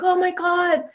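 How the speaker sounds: high-pitched, thrilled exclamation; excited friend. Retold or imitated. Friend hearing the sounds over the phone, excited. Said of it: "she's all excited".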